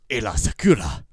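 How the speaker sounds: loud, emphatic AAYLA SAKURA; showy name delivery. Shown as a demonstration. Host shows off his way of saying the name, loud and showy. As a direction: belt out "Aayla Sakura" with a flourish.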